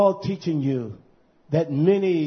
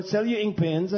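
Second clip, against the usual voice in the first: ordinary talk, nothing marked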